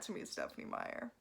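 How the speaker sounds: whispered name. Real, not acted. Vlogger pleading with author Stephenie Meyer in a whisper. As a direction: breathe the name, no voice.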